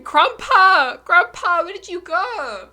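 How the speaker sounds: high, very loud desperate calling; boy screams for vanished grandpa; screaming. A narrator's reading. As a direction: yell across the empty water. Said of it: "Terry screaming".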